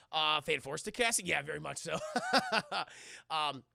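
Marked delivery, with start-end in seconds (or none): none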